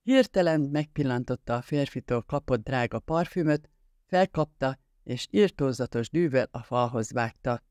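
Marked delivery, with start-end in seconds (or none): none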